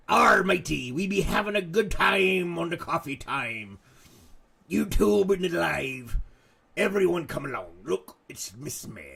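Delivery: pirate voice. Acted. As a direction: growl it out like a movie pirate. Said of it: "Talk Like a Pirate Day".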